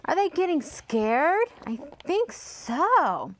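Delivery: plain reading voice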